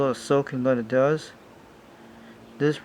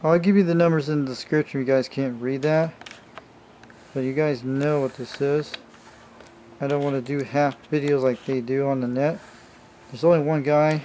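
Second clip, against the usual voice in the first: ordinary talk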